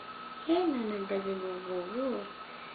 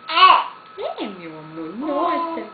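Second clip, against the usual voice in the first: delighted disbelief; 9 semitones higher, 6 dB louder than usual. Real, not acted. Grandma thrilled at baby's babble.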